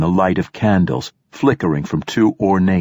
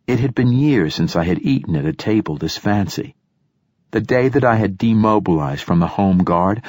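ordinary delivery, nothing marked

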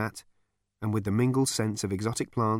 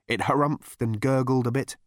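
ordinary delivery

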